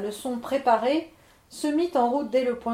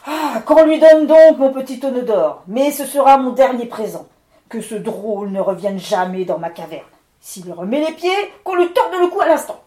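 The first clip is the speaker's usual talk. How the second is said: much louder, imperious orders; commanding storm spirit; 2 semitones higher, 11 dB louder than usual. Acted. Levent ordering his spirits, loud menacing command. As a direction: threaten like a lord of storms. Levent gives final warning.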